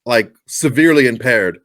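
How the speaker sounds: ordinary talk, nothing marked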